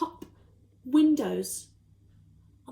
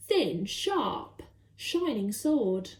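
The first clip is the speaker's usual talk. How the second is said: ordinary delivery, nothing marked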